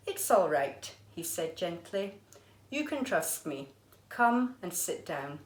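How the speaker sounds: lively storybook reading voice; reading to children. A narrator's reading. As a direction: read it like a picture book for a class. Teacher reads picture book aloud.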